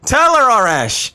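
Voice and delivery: loud, high whoop and cheer; root for him like a fan at a match. Real, not acted. Streamer cheering the archer on, high and loud.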